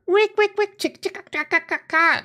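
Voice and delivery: high squawky chicken noises; terrible chicken impression. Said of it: "that was terrible chicken".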